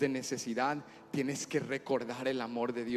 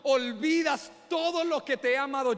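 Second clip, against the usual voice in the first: high, forceful reproach; indignant father; 13 semitones higher, 10 dB louder than usual. Retold or imitated. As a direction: re-enact a dad's mounting, emphatic scolding. Hurt father scolds his son. (reproche indignado de padre)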